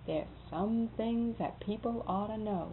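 quiet serious warning; grave and quiet. Retold or imitated. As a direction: lay it down quietly like a secret. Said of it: "he was so serious".

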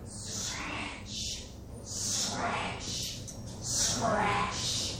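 soft whispered scratch, scratch, scratch; scratching noise. A narrator's reading. Storyteller voicing a critter scratching on the roof. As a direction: breathe the scratches out like claws on wood.

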